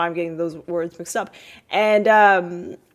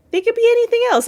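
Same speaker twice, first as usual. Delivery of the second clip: emphatic high point; 5 dB louder, much faster than usual. Real, not acted.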